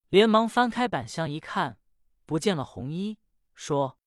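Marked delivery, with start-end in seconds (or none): none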